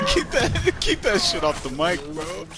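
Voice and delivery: high laughing plea; begging her through giggles to stop; laughing protest. Real, not acted.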